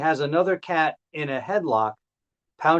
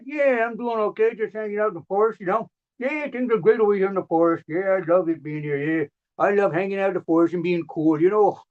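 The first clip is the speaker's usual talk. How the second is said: chatty tree voice; 4 semitones higher, faster than usual. Acted. Tree characters chatting in forest.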